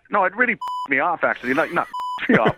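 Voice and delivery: normal talking voice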